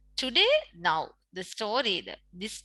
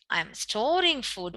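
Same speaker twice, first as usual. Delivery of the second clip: ordinary talk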